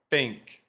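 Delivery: "Think" said as "fink", teeth on lip, no tongue; rabbit face th demo. Shown as a demonstration. Teacher demonstrating the teeth-on-lip trick on "Think". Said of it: "make a rabbit face, where you have your teeth covering your lower lip".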